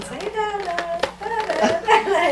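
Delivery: put-on high, coaxing "da da" to a baby; sing-song coaxing of a toddler; coaxing baby talk. Dad coaxing his baby girl to say da da, high and sing-song. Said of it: "Danny was taping her and saying".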